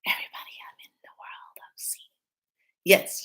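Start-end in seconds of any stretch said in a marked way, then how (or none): 0.0-2.1 hushed whisper fading out; whispered crystal voice. Pretend. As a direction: whisper like the crystal greeting you. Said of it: "you're gonna be like".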